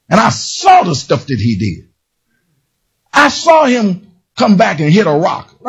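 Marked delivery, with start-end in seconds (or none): none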